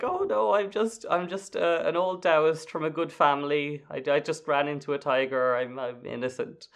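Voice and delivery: high-pitched mock protest, gradually sinking; pretend innocent old Taoist. Demon protesting his innocence to Wukong, high mock-innocent pretence. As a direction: feign helpless innocence in a high voice. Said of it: "he tries to pretend".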